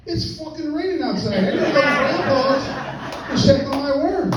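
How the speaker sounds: put-on high, bright little-kid voice, laughing through it; piping toddler asking to go check on his worms; grandson's voice. Grandpa mimics grandson swearing at the window, high kid voice. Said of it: "he goes".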